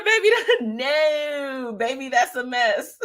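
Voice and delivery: words breaking up into real laughter; high laughing voice struggling to get the words out; laughing while reading. Real, not acted. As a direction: read it through helpless giggles. Cracking up reading comment.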